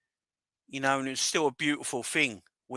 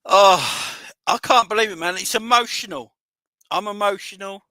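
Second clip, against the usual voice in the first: higher and louder, overwhelmed with real joy; overcome with emotion; 9 semitones higher, 11 dB louder than usual. Real, not acted. Fan talking to his chat after the title win, overwhelmed and emotional. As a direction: voice straining, too emotional to talk properly. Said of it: "Can't really talk properly".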